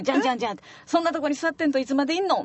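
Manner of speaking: loud Kyoto shop auntie hurrying her customers; gruff shop auntie. Retold or imitated. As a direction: bark at the students to eat up and go. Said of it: "って言われたはんね".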